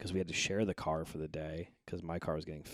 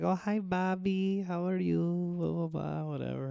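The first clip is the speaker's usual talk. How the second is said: mock cashier voice; 11 semitones higher, 3 dB louder than usual. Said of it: "she's like".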